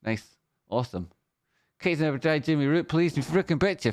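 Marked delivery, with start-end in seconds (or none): none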